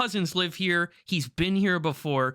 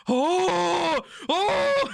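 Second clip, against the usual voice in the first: high, loud mock wail of shock; drawn-out "oh!" shooting way up in pitch; anguished wail; 13 semitones higher, 4 dB louder than usual. God-tier player reacting to the slain dwarves, anguished wail.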